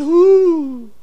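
a playful ooh of pleasure; pleasured ooh. Shown as a demonstration. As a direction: show them the delighted ooh. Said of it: "And say".

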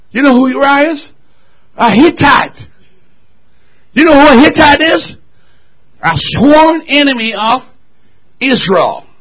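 high, forceful teaching from the pulpit; emphatic preaching. Acted. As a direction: challenge the congregation with each phrase.

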